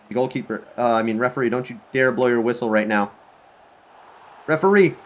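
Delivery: usual speaking voice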